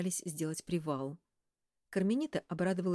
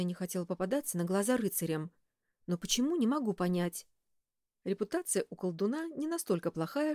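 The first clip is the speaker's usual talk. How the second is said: usual speaking voice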